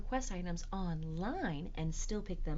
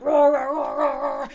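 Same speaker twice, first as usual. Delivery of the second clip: loud, high rapid-fire roars; dinosaur roar; 8 semitones higher, 16 dB louder than usual. Acted. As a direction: roar hard while scrubbing away. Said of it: "we are going to roar".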